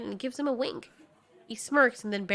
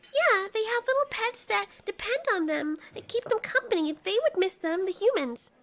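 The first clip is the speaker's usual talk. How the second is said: put-on eager young Marley; 11 semitones higher than usual. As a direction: pitch a new plan to friends. Marley defends the humans.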